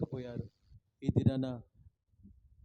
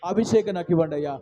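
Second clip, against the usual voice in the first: pleading prayer; 5 semitones higher, 10 dB louder than usual. Acted. Preacher praying for sight, pleading then hushed. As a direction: pray it down to a hushed plea.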